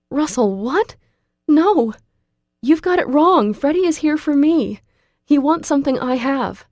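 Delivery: high, loud frantic protest. A narrator's reading. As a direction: rush to correct him in panic. Frantic explanation to Russell.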